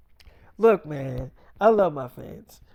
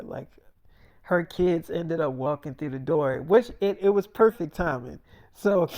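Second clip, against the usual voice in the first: ordinary talk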